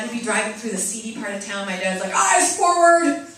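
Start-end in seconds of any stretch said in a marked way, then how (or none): 2.1-3.3 loud, high, slow bark of a strict father; stern dad shout. Retold or imitated. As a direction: bellow the order at the kids in the back seat. Dad shouting at kids in car.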